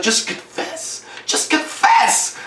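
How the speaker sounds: slow, breathy menacing whisper; creepy whisper. Retold or imitated. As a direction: whisper it slowly to unnerve her. Acted villain pressing the mole.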